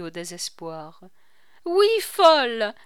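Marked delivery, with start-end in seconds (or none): 1.6-2.7 bitter repetition. A narrator's reading. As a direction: repeat it loud and high, biting. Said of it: "répéta-t-elle". (répète « folle » avec force et amertume)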